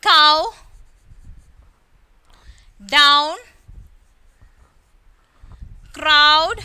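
Cow, down, crowd loud, each with "ow"; OW as in how. Shown as a demonstration. Teacher drilling the table's how column, OW demo. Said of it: "ഇതെല്ലാം how എന്ന രീതിയിലാണല്ലേ വായിക്കുന്നത്".

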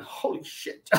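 whispered in disbelief; awed whisper. Retold or imitated. Ronnie walking into a packed seminar, awed whisper. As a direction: breathe it out, stunned.

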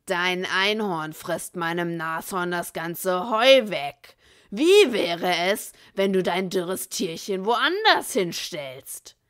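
loud, slow threatening voice; menacing outlaw. A narrator's reading. Bill ordering Andy to move his unicorn, loud. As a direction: push him around with the words.

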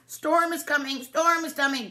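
high, urgent duck call; excited storybook duck; quacking duck. A narrator's reading. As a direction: quack out the warning. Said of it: "Duck quacked".

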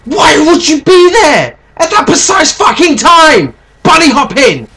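furious high shout; raging gamer. Real, not acted. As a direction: scream at the enemy player. Killed again, shouting.

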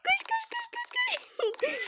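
very high laughing squeal; very high laughing squeal of panic. Real, not acted. Panicked running in game.

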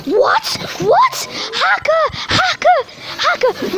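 high, loud yells of disbelief; outraged shout. Real, not acted. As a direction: scream it at the hacker. Caught out by a hacker.

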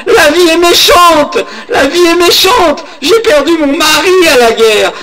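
put-on high, loud, wailing old woman pleading through sobs; crying old woman. Old woman clutching Merlin's cape, begging him, in a high crying wail.

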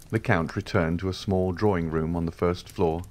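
ordinary delivery

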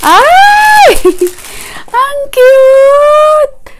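high squealing "ang cute" with a laugh; delighted squeal. Real, not acted. Mom squeals over a tiny baby outfit, excited.

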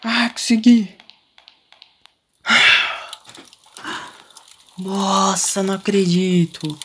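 high, loud cry of relief; thrilled disbelief; elated. Real, not acted. Escapes with all the keys.

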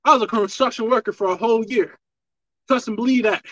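usual speaking voice

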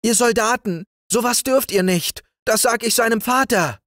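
very high-pitched scolding; high, protesting maid's voice. Acted. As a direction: tell the soldiers off as an alarmed maid. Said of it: "rief Rixer, die Magd".

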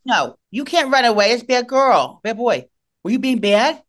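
mock scolding a dog. Real, not acted. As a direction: friend teasing the runaway dog. Scolding the dog in baby talk.

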